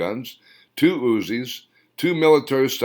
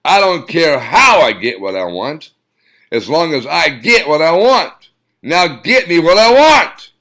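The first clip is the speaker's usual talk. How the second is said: shouting; 8 semitones higher, 12 dB louder than usual. Acted. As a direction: shout it, slowing on the last order. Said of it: "he shouted".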